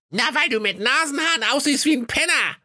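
very high shrill granny voice; a shrill devilish old receptionist. A narrator's reading. The devil's grandma greets the visitor, very high shrill voice. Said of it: "Die Oma erwiderte".

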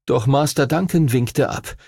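usual speaking voice

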